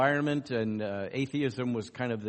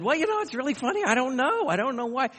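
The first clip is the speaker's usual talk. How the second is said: put-on much higher, quicker voice for the young student; voicing the student; 12 semitones higher, 3 dB louder than usual. Interviewer retelling a student's puzzled answer, in a high voice. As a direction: play the puzzled college girl, light and high.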